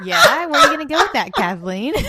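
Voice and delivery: loud, fast teasing through laughter. Real, not acted. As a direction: tease her, laughing loudly. Teasing about sense of touch.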